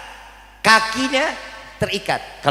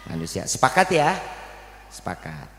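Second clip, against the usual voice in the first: ordinary delivery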